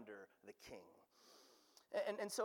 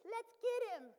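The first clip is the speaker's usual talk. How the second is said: much higher squeaky chipmunk voice; 4 dB louder than usual. Retold or imitated. Pastor voicing raging nations as chipmunks, high squeak. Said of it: "in that chipmunk voice".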